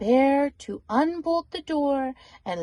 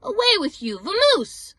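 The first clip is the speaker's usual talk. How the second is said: higher, louder shooing of the intruder; angry shooing; 8 semitones higher, 7 dB louder than usual. Acted. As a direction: chase him out the door. Bear chasing the mouse out.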